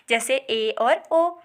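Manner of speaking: ए and ओ sounded slowly and deliberately; vowel demo. Shown as a demonstration. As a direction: show ए and ओ with the mouth half closed. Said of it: "मुख का द्वार होता है वो आधा बंद".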